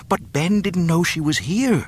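high-pitched earnest protest; arguing. A narrator's reading. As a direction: argue back, voice lifted. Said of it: "Luke argued".

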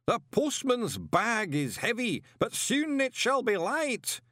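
high, slow solemn pronouncement; echoing ritual voice. A narrator's reading. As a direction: intone it so it rings round the hall. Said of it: "echoed off the walls".

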